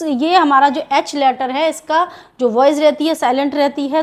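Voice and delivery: normal talking voice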